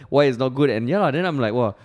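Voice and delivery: normal talking voice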